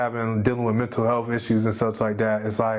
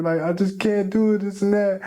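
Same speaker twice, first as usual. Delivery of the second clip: a father imitating his frustrated little girl, pitched up; little daughter's voice; 7 semitones higher than usual. Father quotes daughter's tearful words.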